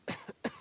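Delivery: a short, pained cough; weak cough. Acted. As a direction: cough from a lingering injury. Injured man coughs mid-sentence.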